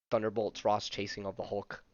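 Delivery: ordinary delivery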